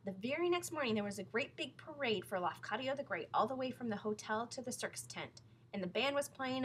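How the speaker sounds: plain reading voice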